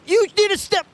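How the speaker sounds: high, loud outburst; mock yell. Streamer yells at the game character, high and loud. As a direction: bark it at the character.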